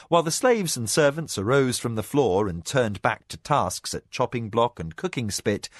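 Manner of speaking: plain reading voice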